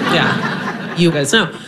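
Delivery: whispered through a laugh; amused aside to the crowd; breathy laughing yeah. Real, not acted.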